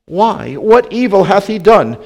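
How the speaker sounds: Pilate protesting. A narrator's reading. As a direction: question the crowd with urgency. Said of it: "Then Pilate said unto them".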